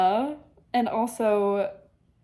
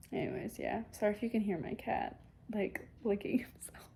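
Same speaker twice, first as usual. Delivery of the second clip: soft whispered aside; 9 dB quieter than usual. Real, not acted. Vlogger apologizes to viewers for her cat, whispered. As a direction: drop to a hush, apologizing.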